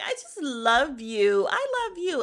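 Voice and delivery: mock a fake declaration of love; phony affection. Host mimicking one frenemy to another, phony gushing. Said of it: "then it goes to the phony".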